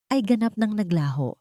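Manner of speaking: ordinary delivery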